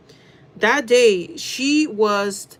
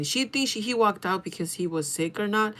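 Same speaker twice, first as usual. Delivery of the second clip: ordinary talk, nothing marked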